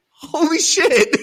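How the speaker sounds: high, loud, slow "holy shit"; stunned exclamation. Retold or imitated. President's stunned reaction, retold.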